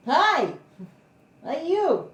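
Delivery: high, lilting hello to a little dog; sudden high coo, falling away; pet greeting. Real, not acted. Greets dog mid-vlog.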